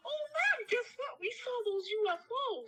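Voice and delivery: put-on high, eager kid telling mom news; excited kid.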